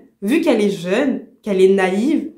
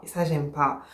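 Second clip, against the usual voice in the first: muttered displeasure, lower; 7 semitones lower, 10 dB quieter than usual. Real, not acted. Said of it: "je suis énervée".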